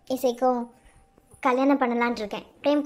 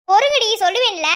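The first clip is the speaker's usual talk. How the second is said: louder, higher wait-up call; impatient shout; 7 semitones higher, 6 dB louder than usual. Acted. Latha hushing the impatient family, raised voice. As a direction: cut them off loudly.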